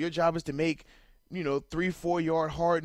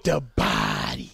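slow, low announcer "The Body"; deep voice; 3 semitones lower, 6 dB louder, much slower than usual. Acted. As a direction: drawl it out like a hype man. Echoing handle in deep voice.

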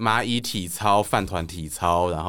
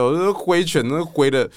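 normal talking voice

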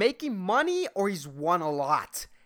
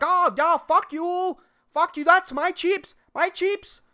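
high, loud, hammed-up furious old guy; angry old man; 13 semitones higher, 5 dB louder than usual. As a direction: shriek it like an outraged old gambler. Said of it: "the old guy's like".

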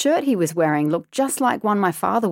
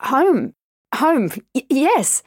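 high, loud, stumbling over the words; stammering fear; 4 semitones higher, 4 dB louder than usual. Acted. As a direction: blurt it out in a panic, tripping on each word. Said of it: "I answered".

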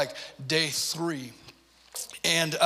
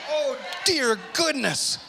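higher and slow, fed up; exasperated groan of disbelief; 9 semitones higher, slower than usual. Retold or imitated. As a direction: let it stretch out in disbelief. Exasperated dad in car.

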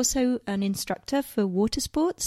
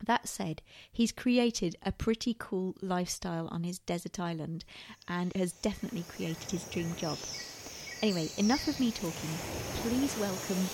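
scripted host intro, even and measured; podcast intro read; 5 semitones lower, 10 dB quieter than usual. Acted. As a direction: read the show intro like an announcer.

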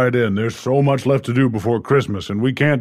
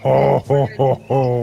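Santa ho ho ho; slower than usual. Acted. Santa laughing for child.

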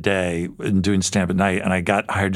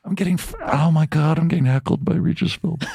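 incredulous; 7 semitones higher than usual. Retold or imitated. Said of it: "And I'm just like".

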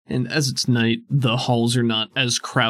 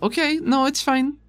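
character voice; 13 semitones higher than usual. Acted. Player voicing character farewell.

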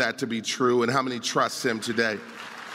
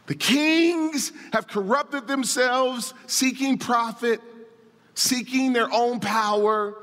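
higher, driving pulpit voice; raised, insistent sermon delivery; impassioned preaching; 8 semitones higher than usual. Real, not acted. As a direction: preach it with urgency, punching key words. Preaches on the passage.

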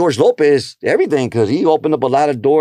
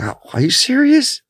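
surprised; 11 semitones higher than usual. Retold or imitated. His own excited reply to DL.

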